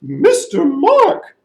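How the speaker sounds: slow, loud, drawn-out trumpeting call; big elephant call. Acted. Elephant stomping up and calling the storyteller. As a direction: boom it out like an elephant.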